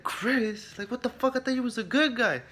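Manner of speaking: high-pitched, incredulous protest; retold shocked outcry; mock disbelief. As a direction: act out feeling betrayed by an ally, voice way up. Acts player's shock at Chris's betrayal.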